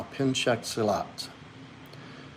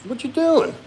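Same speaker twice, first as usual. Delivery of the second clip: pet talk; 14 semitones higher than usual. Real, not acted. Cooing at the dog.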